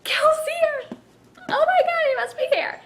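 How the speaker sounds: very high, breathless thrill; excited gasp. Retold or imitated. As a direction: squeal it like a fan who can't believe it. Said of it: "I was just so excited".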